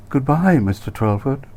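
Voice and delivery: lightly voiced flirty farewell, high start; a hint of teasing sweetness; coy goodbye.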